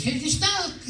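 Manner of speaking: very high mimicked woman's voice; chirpy wardrobe girl. Wardrobe girl handing the actor his costume, high mock voice.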